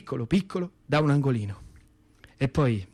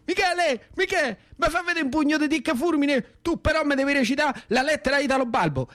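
put-on high eager little-boy voice; child begging; 11 semitones higher than usual. Little brother asking Michele for a punch, high pleading.

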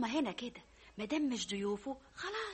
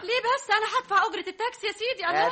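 loud protest; 9 semitones higher, 8 dB louder than usual. Acted. Daughter protests loudly.

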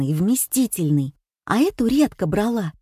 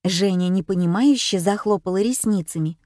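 usual speaking voice

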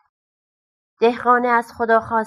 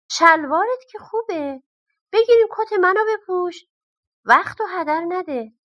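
higher, quick coaxing miser voice; higher-pitched, hasty miser pressing the peasant; pushy miser; 7 semitones higher than usual. A narrator's reading. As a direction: hurry him along, the greedy miser urging.

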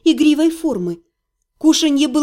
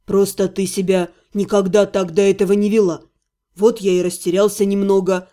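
embarrassed man; 6 semitones lower than usual. A narrator's reading. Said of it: "смущённо признался".

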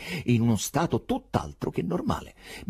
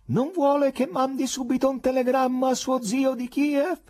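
high, loud call as through a megaphone; shouting through cupped hands; 4 dB louder than usual. Acted. The professor calls across the park to Berlioz through cupped hands. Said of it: "mani a megafono davanti alla bocca".

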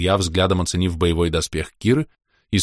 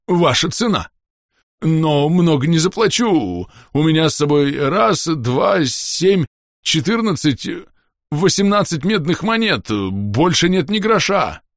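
high voice, counting out coins; eager haggling porter; 11 semitones higher, 4 dB louder, slower than usual. Acted. Axolotl porter bargains with half-orc for secrets, eager and high. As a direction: plead poverty in a high, stretched voice.

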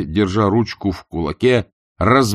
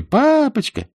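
put-on children crying out; 3 dB louder, slower than usual. A narrator's reading. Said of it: "кричали".

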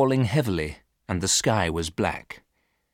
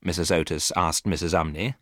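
ordinary delivery

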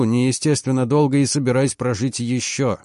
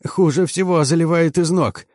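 higher, mildly anxious report; trembling voice; 6 semitones higher than usual. A narrator's reading. Chief mechanic reports flooding to commander, trembling. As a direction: answer with a touch of a tremble. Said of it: "ответил он дрожащим голосом".

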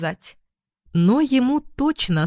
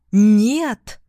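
louder, drawn-out refusal; emphatic refusal; 6 dB louder than usual. A narrator's reading. Ex-husband repeats his refusal to ex-wife, loud and emphatic.

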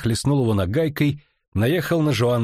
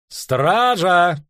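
calling the guard; 8 semitones higher, 5 dB louder than usual. A narrator's reading. Tribunal chair calls the guard.

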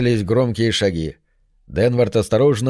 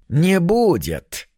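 slow, ominous sinister voice; slow theatrical villain menace; 7 semitones higher, much slower than usual. A narrator's reading. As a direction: draw it out like a gloating villain. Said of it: "зловеще произнёс знакомый шепоток".

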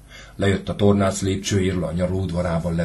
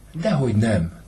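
higher, shrugging protest; 6 semitones higher, 4 dB louder than usual. Acted. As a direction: brush the charge off with a shrug. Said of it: "tiltakozott felesége vállát vonogatva". (vállat vonva tiltakozik)

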